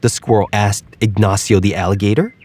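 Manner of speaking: ordinary delivery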